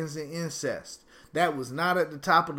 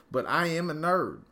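normal talking voice

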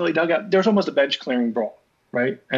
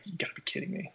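breathy whisper, much softer; whispered disbelief; 7 semitones higher, 15 dB quieter, faster than usual. Retold or imitated. Pitcher after a near-homer foul, whispered disbelief. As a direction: mutter it under your breath in disbelief.

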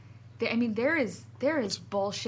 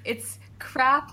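hiccup giggles; 4 semitones higher, 3 dB louder than usual. Real, not acted. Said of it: "You can't say it".

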